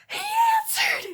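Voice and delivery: very high, loud squeal; re-enacted hysterical fangirl scream; excited shriek. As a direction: shriek the news down the phone. Acting excited call to best friend.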